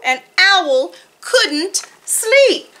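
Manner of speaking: deliberate picture-book narration with vivid animal noises; story reading to children. A narrator's reading. Narrator tells children of sleepless Owl, deliberate expressive storytelling. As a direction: read to little ones, spacing out the refrain.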